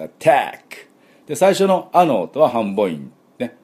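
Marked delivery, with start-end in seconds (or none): 0.0-0.9 アタック with the second a stressed; English attack. Shown as a demonstration. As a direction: push force on the second syllable of アタック. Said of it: "ここに力を入れて".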